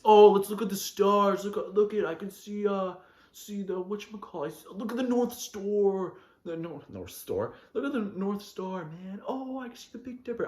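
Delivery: hammed-up wonder to impress a girl; mock dreamy suitor. Guy chatting up a girl under the stars, mock dreamy. Mimics guy's stargazing pickup line.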